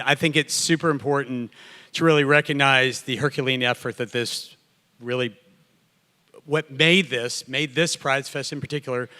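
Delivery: ordinary delivery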